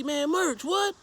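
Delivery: very high-pitched imitation of a startled friend; high retold "what?" reaction; mock disbelief. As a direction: mimic a friend caught off guard on the phone.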